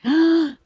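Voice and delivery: sharp, high intake of breath; stunned gasp. Retold or imitated. Wife hears the prophecy about her husband, stunned gasp. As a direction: act out a gasp of shock. Reacting to the pastor's retold prophecy.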